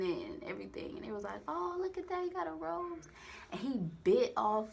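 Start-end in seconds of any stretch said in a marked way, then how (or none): 1.5-3.1 high-pitched teasing mimicry; mock teasing. Mimicking the manager about her rose, high mock teasing. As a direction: tease in a put-on high voice.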